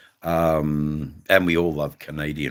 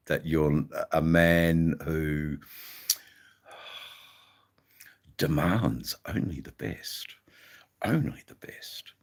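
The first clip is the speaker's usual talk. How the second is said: whispered with mock reverence; soft teasing whisper; 5 dB quieter than usual. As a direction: hush it like a secret. Mock reverent aside to viewer.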